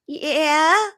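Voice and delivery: high, rising, uncertain yes; mock hesitant wife. Bride asked by the king to care for Daniel, mock hesitant. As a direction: answer up high like an unsure bride.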